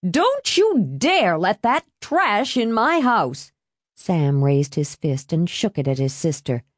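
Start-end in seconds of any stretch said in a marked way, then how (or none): 0.0-3.5 high, slow, emphatic scolding; shrill outraged aunt; shrill warning. A narrator's reading. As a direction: warn shrilly, drawing out each threat. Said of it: "warned his aunt's shrill voice".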